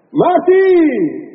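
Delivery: very high, loud, held shout; grito. Shown as a demonstration. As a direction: belt it out to get the crowd up. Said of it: "voy a gritar". (grito muy agudo, fuerte y sostenido)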